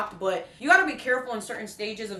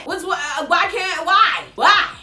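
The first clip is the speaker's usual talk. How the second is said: exasperated why; 7 semitones higher, 10 dB louder than usual. Real, not acted. Exasperated at stylist refusing.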